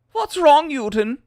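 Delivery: put-on shouted high taunt in German accent; mock German accent. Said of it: "my caricature of a German person".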